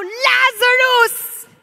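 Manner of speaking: high, loud, slow ringing call; drawn-out commanding cry of the name; commanding shout. Retold or imitated. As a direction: call the dead man out with full force. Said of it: "Tapos bigla siya sumigaw".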